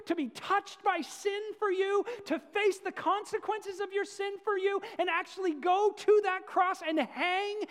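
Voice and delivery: loud, high, pleading preacher's cry; a fervent shout rising to a peak; impassioned outburst. Acted. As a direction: thunder it out as God pleading with his people. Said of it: "sorry for flipping out on you".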